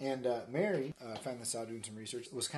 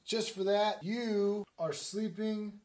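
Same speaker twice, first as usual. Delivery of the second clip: loud, higher mock outrage; hammed-up angry husband; indignant husband; 7 semitones higher, 11 dB louder, slower than usual. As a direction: lay down the punishment, emphatically. Acted quarrel, Joe rejects Mary.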